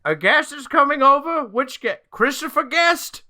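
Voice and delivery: high, loud fussing voice; high-pitched mock panic; high mock confusion. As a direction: squeak in mock bafflement.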